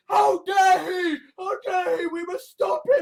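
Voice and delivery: high-pitched indignant shouting; hammed-up rallying cry against a villain; mock outrage. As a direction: cry out, scandalised and urgent. Acts out outraged political opponents.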